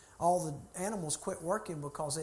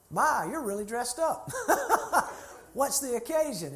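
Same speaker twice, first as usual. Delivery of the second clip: mock amazed remark; 7 semitones higher, 5 dB louder than usual. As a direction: act out marvelling at the obvious. Stating the obvious to a stranger.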